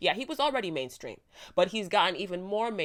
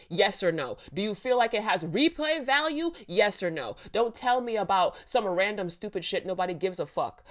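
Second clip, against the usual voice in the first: ordinary talk, nothing marked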